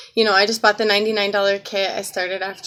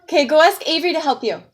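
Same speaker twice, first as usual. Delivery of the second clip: yelling across the room; 6 semitones higher, 4 dB louder, faster than usual. Real, not acted. Tells child to ask sibling.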